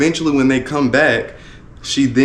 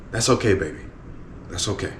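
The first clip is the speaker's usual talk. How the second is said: soft reassuring dad; 6 semitones lower, 8 dB quieter, faster than usual. Retold or imitated. Dad soothing upset daughter.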